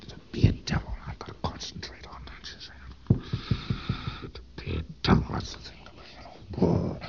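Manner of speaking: a Thetan muttering his new goal to himself; whispered concentration. Retold or imitated. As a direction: whisper the goal over and over to fix it.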